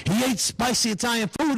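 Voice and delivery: ordinary talk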